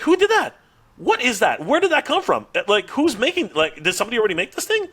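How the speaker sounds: high-pitched, tumbling astonished questions; freaking out. Retold or imitated. Demanding who already made the toy, freaking out. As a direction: fire off questions in rising disbelief. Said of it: "both Hatter and I freaking out".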